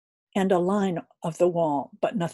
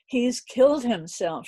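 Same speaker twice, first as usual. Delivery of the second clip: landlady crying out; 5 semitones higher, 3 dB louder than usual. A narrator's reading. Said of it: "she cried".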